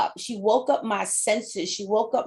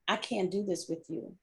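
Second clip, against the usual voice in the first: hushed, much softer, near tears; retold tearful plea; 9 dB quieter than usual. Coworker Lisa telling her boss she can't go on, hushed and tearful. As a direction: soften right down as if about to cry. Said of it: "she started crying".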